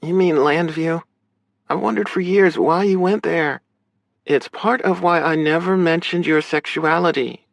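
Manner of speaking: high-pitched sister character voice; questioning, high female voice; big sister. Acted. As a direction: press him for an explanation in a high voice.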